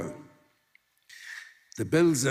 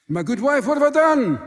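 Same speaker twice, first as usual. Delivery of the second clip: high, loud desperate plea; pleading husband; 10 semitones higher, 5 dB louder than usual. Acted. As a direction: cry out in disbelief, high and loud.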